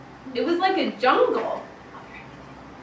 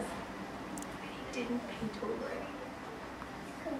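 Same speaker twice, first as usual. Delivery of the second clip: slow, hushed whisper; 18 dB quieter, much slower than usual. Acted. Teacher whispering to the class for suspense. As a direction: whisper it like a secret.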